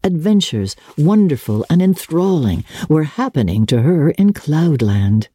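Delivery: ordinary delivery, nothing marked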